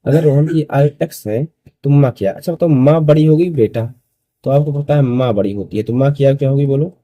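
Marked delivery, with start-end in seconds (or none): none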